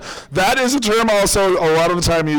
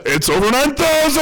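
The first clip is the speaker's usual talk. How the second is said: meme shout; 11 semitones higher than usual. Shown as a demonstration. Showing the crowd the over nine thousand meme, shouted high. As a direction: belt it like the anime meme. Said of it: "you're doing it wrong".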